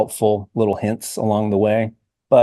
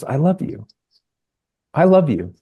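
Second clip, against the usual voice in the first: emotional; 6 semitones higher than usual. Real, not acted. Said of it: "I'm getting emotional".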